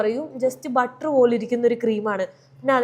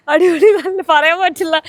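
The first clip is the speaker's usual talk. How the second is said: louder, teeth chattering from cold; 5 semitones higher, 7 dB louder than usual. Real, not acted. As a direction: push words through chattering teeth. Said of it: "പല്ല് കൂട്ടിയിടിക്കുന്നുണ്ട്".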